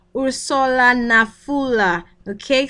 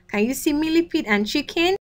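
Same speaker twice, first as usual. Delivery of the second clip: plain reading voice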